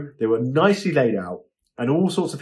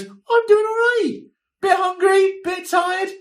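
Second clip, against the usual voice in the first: much higher, slow small squeaky voice from behind camera; little voice; slower than usual. Retold or imitated. Assistant behind the camera answers the host in a small high voice. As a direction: play a tiny off-camera voice, a touch weary. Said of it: "little voice behind the camera goes".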